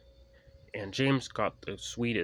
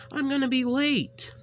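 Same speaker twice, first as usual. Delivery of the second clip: high, loud, urgent little engine; high Percy voice; 8 dB louder than usual. A narrator's reading. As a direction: push hard, you're late for the mail. Percy frets.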